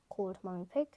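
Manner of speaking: usual speaking voice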